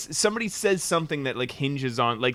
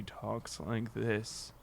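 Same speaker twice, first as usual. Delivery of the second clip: slow, hushed, stoned mimicry; spaced-out, druggy voice; 5 semitones lower, 14 dB quieter, much slower than usual. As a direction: trail along slowly and softly as if drugged. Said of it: "seems like she's on drugs".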